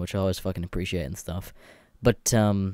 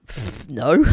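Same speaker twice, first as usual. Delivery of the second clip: ordinary talk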